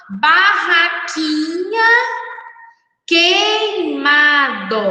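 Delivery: teacher modelling "Barraquinha" and "queimado" slowly, syllable by syllable; slow model reading. Shown as a demonstration. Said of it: "eu vou ler aqui e você lê".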